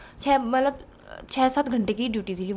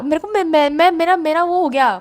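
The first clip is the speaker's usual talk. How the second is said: ordinary delivery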